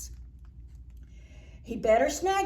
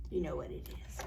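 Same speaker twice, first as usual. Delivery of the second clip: ordinary delivery, nothing marked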